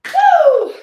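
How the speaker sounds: loud high whoop; excited woo. Real, not acted. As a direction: cheer the class on. Cheering between exercises.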